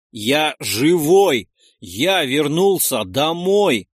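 slow, loud proclamation; loud, drawn-out desperate cry. A narrator's reading. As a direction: hammer it out, stretching every word. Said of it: "Громко сказал я".

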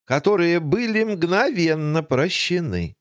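usual speaking voice

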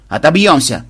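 loud defiant bark. A narrator's reading. Commander defies the approaching boarders, barking. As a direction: bark it out with fighting confidence. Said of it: "рявкнул Банзай".

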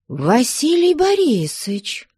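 high, drawn-out amazement; can't believe who it is; astonished exclamation. A narrator's reading. Father recognises the groom at the window, astonished cry.

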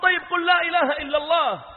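loud, very high urging; retold last-ditch cry; desperate shout. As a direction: shout the plea with full insistence. Final shouted plea to youth.

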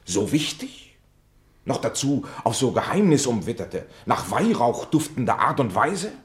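mocking incredulity. Challenging Michaelis about his incense-scented mystery, mocking and incredulous. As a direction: build the question up with scornful wonder.

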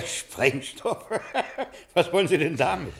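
laughing. Acted. Amused shopkeeper hears odd request.

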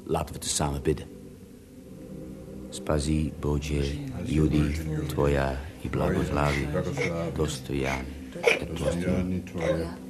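soft, murmured Russian prayer. Acted. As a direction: pray quietly over the sick boy. Rasputin leads a prayer.

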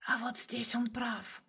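soft, slow, sneering whisper; sly inner voice whisper. A narrator's reading. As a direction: hiss it like a mocking voice in his head. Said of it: "ехидно произнёс внутренний голос".

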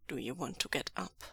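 very soft, low voice; gentle question. A narrator's reading. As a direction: ask softly and low, to someone dozing. Lan Zhan gently asks sleepy partner.

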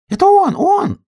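very high, loud squeal; excited squirrel cry from up on a star; squirrel shouting. A narrator's reading. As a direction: squeal it down at full pitch. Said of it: "крикнула со звезды белка".

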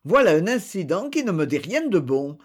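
usual speaking voice

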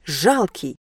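ordinary delivery

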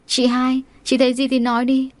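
ordinary delivery

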